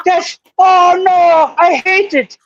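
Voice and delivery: high, drawn-out fake Irish wail; mock Irish accent. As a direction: imitate an indignant Irishman, hammed up. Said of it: "with that fucking accent".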